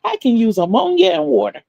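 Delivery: delighted exclaim. Retold or imitated. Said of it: "I'm like".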